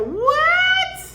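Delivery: high, loud squeal of disbelief; shocked what. Real, not acted. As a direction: shriek it in shock. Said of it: "you too young to talk about retire".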